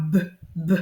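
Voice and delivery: "b" as two short separate bursts; bouncing B sound. Shown as a demonstration. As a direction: bounce "b" twice, pausing between. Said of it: "je le fais sauter avec le trampoline".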